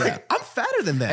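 high-pitched comic disbelief; hammed-up shocked conclusion; mock alarmed realisation. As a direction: pitch it up in playful dismay. Retold realisation continues.

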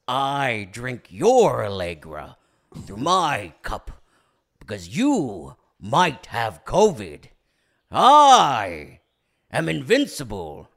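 slow, booming villain declamation; hammed-up grandiose oratory; Daniel Day-Lewis. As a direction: swoop up loud on key words, long pauses. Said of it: "I do a much better, like, Daniel Day-Lewis impression".

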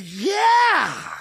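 high, loud cry of yes; overjoyed squeal of a yeah; thrilled answer to the secretary, high yelp; excited. Retold or imitated.

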